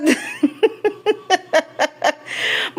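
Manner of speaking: long run of chuckles; real laugh. Real, not acted. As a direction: laugh on and on at the pet's temper. Laughing at the angry dog. (mahabang tawa)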